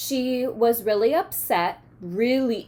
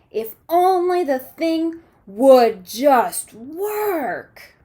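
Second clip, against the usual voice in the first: slow, louder frustrated plea; 2 semitones higher, 6 dB louder, much slower than usual. Acted. Girl fed up with her failed invention, slow and frustrated. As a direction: wish it would just work, fed up. Frustrated girl's thought in story.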